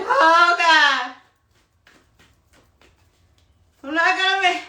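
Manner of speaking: high, loud comic dismay; mock panic. Traveler at the wrong gate fearing a missed flight, mock panic. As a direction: wail it as if the gate is hopelessly far.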